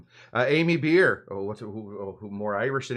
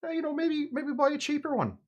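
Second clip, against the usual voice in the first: very high, small mock quote voice; tiny high voice with an Irish lilt; James Joyce; 13 semitones higher, 3 dB quieter than usual. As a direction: put on a high-pitched voice for a made-up quote. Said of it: "James Joyce, I believe, once said".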